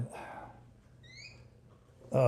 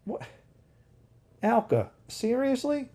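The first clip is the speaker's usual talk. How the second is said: incredulous; 11 semitones higher than usual. Real, not acted. Reacting to the comment.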